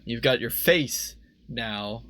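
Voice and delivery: ordinary delivery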